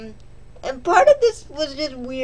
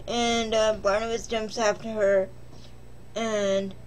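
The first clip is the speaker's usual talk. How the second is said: normal talking voice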